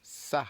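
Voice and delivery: sa said crisply as one syllable, s plus open a; French syllable sa. Shown as a demonstration. Teacher modelling the syllable sa for learners, crisp and clear. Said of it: "S A is pronounced".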